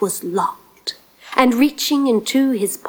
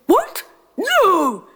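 high, incredulous squawk; indignant disbelief; 10 semitones higher than usual. A narrator's reading. Giant rejecting prince's toilet guess, indignant high yelp. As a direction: leap up in pitch, appalled.

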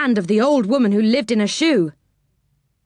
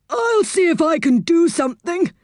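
high, put-on helpful character voice; Saucepan man; 10 semitones higher than usual. A narrator's reading. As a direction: offer help in a high character voice. Saucepan man's character voice in story.

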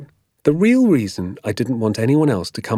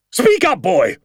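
high, loud barked order; impatient shout; 12 semitones higher, 5 dB louder, slower than usual. Acted. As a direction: explode at a silent pupil. Teacher loses patience with pupil.

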